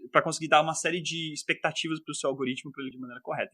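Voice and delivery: ordinary talk, nothing marked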